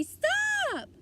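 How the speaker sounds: high, loud piercing yell. A narrator's reading. Doll-girl Taya yells at the man, very loudly. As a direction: scream it to make them stop. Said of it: "yelled very loudly".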